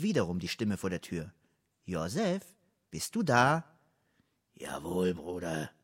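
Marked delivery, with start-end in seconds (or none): 1.8-3.6 higher, questioning call through the door; fragende Stimme vor der Tür. A narrator's reading. Voice outside the door asking. 4.5-5.7 low, soft, falling answer; resignierte Antwort. A narrator's reading. Locked-in man answers caller.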